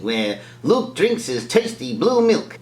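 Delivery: normal talking voice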